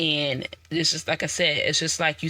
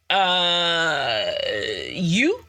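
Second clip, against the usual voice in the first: hesitant answer. Retold or imitated. Acting out hesitant answer to God.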